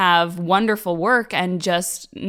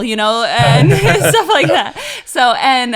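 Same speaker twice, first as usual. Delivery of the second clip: real laughter breaking through the words, louder; laughing; 5 semitones higher, 8 dB louder than usual. Real, not acted. Laughing mid-sentence.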